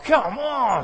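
ordinary delivery

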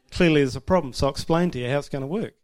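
loud, amused self-parody; mock lecturing. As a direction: imitate a bossy husband setting her straight. Voicing his bossy younger self to wife.

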